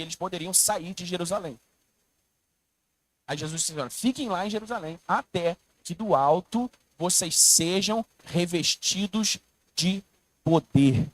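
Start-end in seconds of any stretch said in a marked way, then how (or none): 3.8-11.1 slow build ending on a loud poder. Acted. Preacher quoting Jesus, slowing into a loud poder. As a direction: drag revestidos de, then punch poder.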